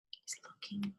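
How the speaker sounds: storyteller's hushed whisper; whispered aside. Real, not acted. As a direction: hush it like sharing a secret. Aside to child while reading.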